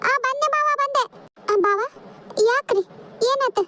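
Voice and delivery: high, hurried reply to a summons; calling back. Acted. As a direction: answer from a distance that you're coming. Answers brother-in-law's summons. (ದೂರದಿಂದ ಓಗೊಡುವ ಧ್ವನಿ)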